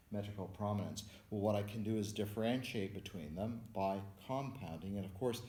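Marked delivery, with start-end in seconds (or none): none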